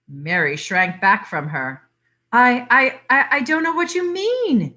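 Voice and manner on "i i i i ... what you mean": high, halting and faltering; a touch of frightened stammering; mild unsteady fear. Acted. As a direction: stammer it out, shaken and unsure. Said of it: "she said unsteadily".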